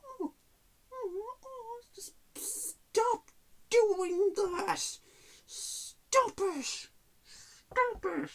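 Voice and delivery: mock tearful pleading, drawn out slowly on "just stop doing that"; mocking Anne Hathaway. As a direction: parody an actress sobbing and pleading in a shrill falsetto. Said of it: "she's just doing this, you know, like".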